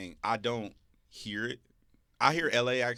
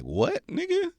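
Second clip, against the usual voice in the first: much higher, disbelieving snap; incredulous retort; 6 dB louder, slower than usual. Retold or imitated. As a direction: fire back in total disbelief. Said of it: "And I'm like".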